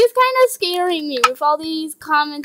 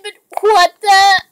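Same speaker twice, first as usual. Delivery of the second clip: ordinary talk, nothing marked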